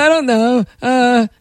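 halting, vacant mock mumble; dopey boyfriend. Boyfriend asked about breaking up, dopily dithering. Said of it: "this is how my boyfriend sounds to me".

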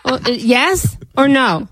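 ordinary delivery

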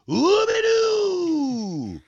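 high, stretched sung-out shout; mocking Boston's beer with a high drawn-out call; mock fanfare. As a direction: belt out a silly high fanfare. Mocking Sam Adams as a claim.

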